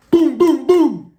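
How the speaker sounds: very high, loud pounding booms; imitated door banging. Police pounding on the door again, loud booms. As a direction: hammer them out like fists on the door.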